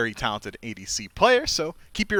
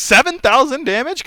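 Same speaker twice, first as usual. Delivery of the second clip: high, loud disbelief; in mock shock at the number; incredulous; 12 semitones higher, 6 dB louder than usual. Real, not acted. Mocking a player's low damage.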